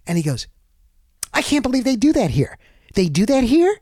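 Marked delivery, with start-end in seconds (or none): 1.3-3.8 high, incredulous mock-neighbor voice, pitched up; imitating the neighbor. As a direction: play a stunned resident who can't believe it. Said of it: "That's how he said it".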